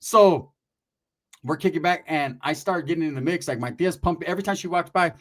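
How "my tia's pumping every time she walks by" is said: usual speaking voice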